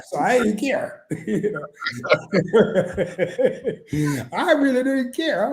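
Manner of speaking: laughing through the words, a little high; a bit high, chuckling as you admit it; giggly and high. Real, not acted. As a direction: let the giggles break through. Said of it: "I'm a little high".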